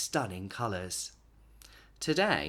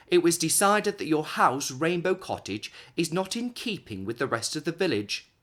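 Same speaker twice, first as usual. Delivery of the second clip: a touch of pompous officialdom, louder; official letter; 5 semitones higher, 5 dB louder than usual. Acted. Council announcing the cottage must be painted grey, officious. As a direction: read it like a stiff bureaucrat.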